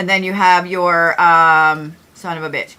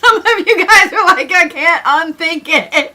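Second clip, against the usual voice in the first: high-pitched, loud laughing talk; 10 semitones higher, 4 dB louder than usual. Real, not acted. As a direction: squeal it out through laughter. Cracking up at chat comments.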